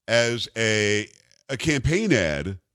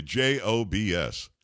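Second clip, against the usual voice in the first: spelled out slowly; 4 semitones lower, much slower than usual. Retold or imitated. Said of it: "three letters for you".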